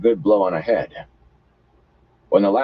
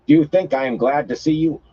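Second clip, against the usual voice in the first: scornful woman; 9 semitones higher than usual. A narrator's reading.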